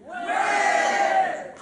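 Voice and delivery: high, airy imitation of mass cheering; crowd cheer. Workers acclaim self-management, imitated crowd cheer. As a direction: roar approval like a whole assembly. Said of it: "tout le monde crie".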